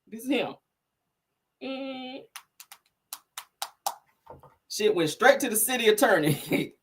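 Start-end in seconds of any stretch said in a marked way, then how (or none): none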